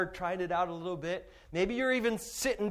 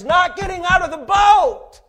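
loud, high preaching climax; preaching shout; 12 semitones higher, 14 dB louder than usual. Real, not acted. Preacher driving home the point, loud and high. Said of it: "preaching climax".

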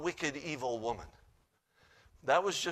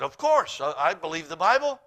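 affronted insistence; 2 semitones higher, 9 dB louder than usual. Retold or imitated. Woman answering whether she believes, affronted. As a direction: insist loudly, as if offended.

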